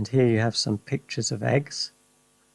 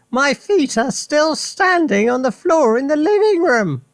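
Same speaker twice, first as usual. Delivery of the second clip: put-on high, loud old-woman cackle; Grandma cackles, high and loud; cackling Grandma; 14 semitones higher, 5 dB louder than usual. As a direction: cackle it out gleefully. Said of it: "cackled the old woman".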